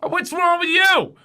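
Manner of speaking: very high-pitched, loud indignation; exasperated shouting. Acted. Arguing over potty and party, exasperated shout. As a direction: insist on it at full pitch, incredulous.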